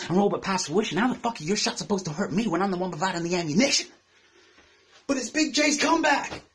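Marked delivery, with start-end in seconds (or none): 5.1-6.4 high-pitched mock excitement; mock hype. Battle rapper mocking a rival's return, mock hype.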